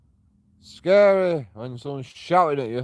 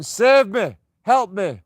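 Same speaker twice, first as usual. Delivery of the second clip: mock cry for help; 8 semitones higher, 5 dB louder, slower than usual.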